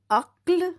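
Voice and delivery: अक्ल said high and firm, k only touched; clear model word. Shown as a demonstration. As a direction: model अक्ल crisply for the class. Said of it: "बहुत कम stress".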